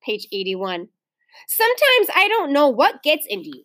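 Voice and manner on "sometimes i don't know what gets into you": higher start, emphatic scolding; exasperated. A narrator's reading. Mother reproaches Ramona about the dog, exasperated. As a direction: reprove with worn-out patience. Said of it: "Even Mother sounded exasperated".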